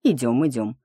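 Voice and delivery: plain reading voice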